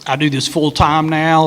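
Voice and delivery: usual speaking voice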